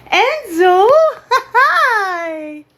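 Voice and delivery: high, loud, laughing hello to the dog; excited greeting. Real, not acted. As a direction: burst out happy to see him.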